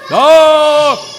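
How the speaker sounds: high, loud gasp of surprise; a delighted "oh" at the answer; surprised oh. Real, not acted. Reacting to children's answer.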